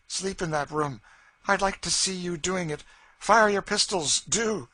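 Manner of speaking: a hint of a woman daring her master; taunting Cassie. A narrator's reading. Cassie dares Legree to sleep in that room, taunting.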